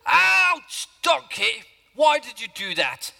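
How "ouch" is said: sudden high yelp of pain, loud; pained shout. Acted. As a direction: cry out as you hit the ground, high and loud. Balaam thrown off by donkey.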